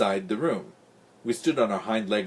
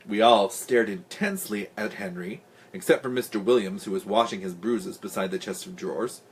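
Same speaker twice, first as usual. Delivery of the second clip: ordinary delivery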